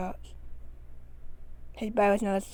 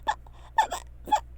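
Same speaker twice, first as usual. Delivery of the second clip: stammering hen clucks, whispered; slurred clucking; 3 dB louder, much slower than usual. A narrator's reading. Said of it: "clucks into a slur".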